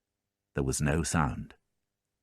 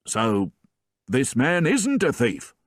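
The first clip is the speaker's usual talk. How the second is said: surprised sergeant; 6 semitones higher than usual. A narrator's reading.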